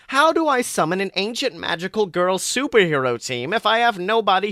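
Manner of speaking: villain whining about his bad luck; whiny Voldemort. A narrator's reading. Voldemort complaining to himself.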